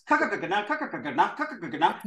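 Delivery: rapid soft drill; very fast. Shown as a demonstration. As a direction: roll it off in one breath. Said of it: "when you say that really fast".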